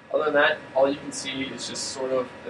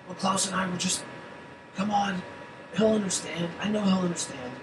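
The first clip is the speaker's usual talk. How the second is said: put-on higher-pitched pleading young voice; Yank's voice; 6 semitones higher than usual. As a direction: whine it like a bored kid making excuses. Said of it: "does that one sound like Yank?".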